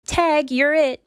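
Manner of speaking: high, loud playful shout; a dog starting a game of tag, shouting. Acted. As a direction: call it out as she dashes off. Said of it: "Then she shouts".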